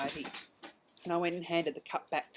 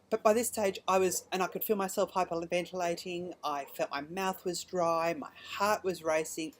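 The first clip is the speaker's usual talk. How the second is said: ordinary delivery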